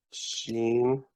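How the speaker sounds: "sheen" with a long, held ee; long-E example. Shown as a demonstration. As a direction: show the ee sound in "sheen". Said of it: "the E, as in".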